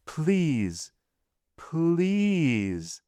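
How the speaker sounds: high, long-drawn begging; pleading. A narrator's reading. Accused wife begs her husband for mercy, pleading. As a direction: wail the word twice, the second longer.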